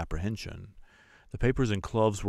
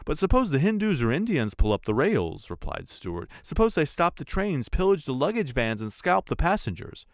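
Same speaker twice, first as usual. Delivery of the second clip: higher, louder, worked-up objecting; agitated Stewart; 8 semitones higher, 6 dB louder than usual. A narrator's reading. Stewart argues against Fogg over the card table, agitated. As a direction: press each objection harder and higher.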